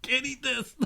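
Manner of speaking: high strained voice choking on smoke; choking high mock disgust. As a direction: sound disgusted and half choking. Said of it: "I'm like".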